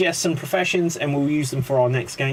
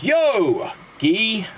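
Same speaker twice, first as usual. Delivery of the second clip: bartender punchline; 4 semitones higher than usual. Acted. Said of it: "the bartender sees them and goes".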